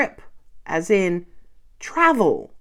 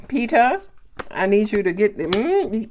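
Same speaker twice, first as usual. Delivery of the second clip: ordinary talk, nothing marked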